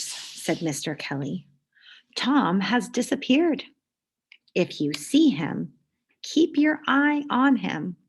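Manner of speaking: lively storybook read-aloud; reading to children. A narrator's reading. Teacher reading a picture book to the class, lively. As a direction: read it like story time for kids.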